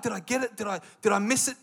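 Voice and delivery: ordinary talk, nothing marked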